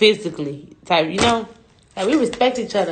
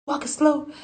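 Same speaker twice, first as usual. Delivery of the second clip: imitating security staff; 8 semitones higher than usual. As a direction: mimic a security guard ordering, voice raised high. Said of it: "I know I'm talking like the people".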